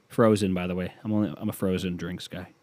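usual speaking voice

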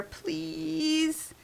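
high, soft drawn-out plea; pleading. A narrator's reading. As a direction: beg softly like a hopeful puppy. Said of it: "put his hands together in prayer".

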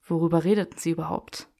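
plain reading voice

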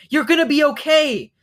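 high, loud call; yelled reassurance. A narrator's reading. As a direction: yell comfort over roaring flames. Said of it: "Roz yelled over the roar of fire".

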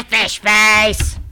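loud parting insult; taunting yell. Acted. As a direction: fling the name out. Parting insult yelled at Gertie.

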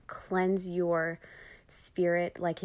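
ordinary talk, nothing marked

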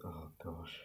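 dismayed whisper. Real, not acted. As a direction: breathe it out in a whisper. Dismayed aside after the bad impression.

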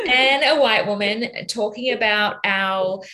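normal talking voice

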